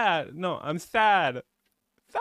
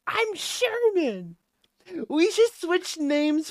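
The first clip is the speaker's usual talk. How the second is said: mock high voice; 10 semitones higher, slower than usual. Mock lisp name swap.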